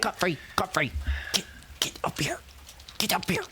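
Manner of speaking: whisper yell. Acted. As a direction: hiss the call up the hill. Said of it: "yelled down the hill".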